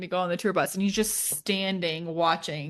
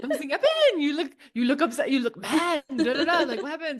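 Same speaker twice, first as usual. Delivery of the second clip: laughing through a mock French accent; 6 semitones higher than usual. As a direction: imitate a French guy, pitched up. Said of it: "He was, like, French".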